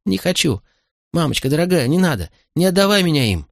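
put-on high-pitched begging little boy; acted boy's wail, pitch well up; child's wailing. A narrator's reading. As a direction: plead like a panicked child. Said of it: "сквозь его вопли".

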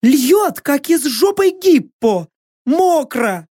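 high-pitched complaint; girl's yelping voice. A narrator's reading. Girl runs in from the rain, high yelping complaint.

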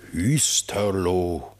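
Hüsterlo drawn out slowly, falling; doubtful drawl. Acted. King doubting Reineke's place name, slowly.

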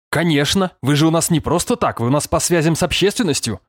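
high-pitched sarcastic boss; snide jibe. A narrator's reading. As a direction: needle her with mock understanding. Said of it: "съязвил Сергеев". (язвительно, с издёвкой)

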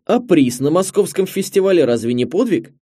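loud, high, emphatic lament; dismayed. A narrator's reading. Director reminding young filmmaker of his festival prize, dismayed. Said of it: "сокрушённо продолжает директор".

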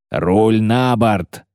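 slow, stretched growl of command; growled order. A narrator's reading. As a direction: growl it at the helmsman. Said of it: "зарычал подларак". (рычащая команда)

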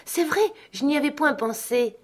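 overjoyed realisation, pitched high; cry of joy. A narrator's reading. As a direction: gasp it out in sudden delight. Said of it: "Elle poussa un cri de joie".

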